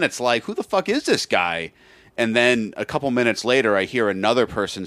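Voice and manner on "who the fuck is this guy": indignant, pitched way up; fuming. Retold or imitated. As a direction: seethe at the stranger in a high voice. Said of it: "steaming. I'm fuming".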